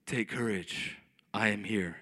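very low, solemn God voice; booming divine voice, mocked. Preacher mocks how Jesus didn't sound, deep and solemn. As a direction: intone it deep and grand, as parody. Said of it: "it wasn't that audible".

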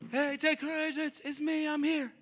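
high, thin far-off call; distant calling. Acted. Jesus calling to a boat in chaos, distant shout. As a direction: call across noisy water, held back in volume. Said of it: "that's probably more what it sounded like".

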